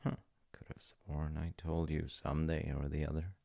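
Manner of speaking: ordinary delivery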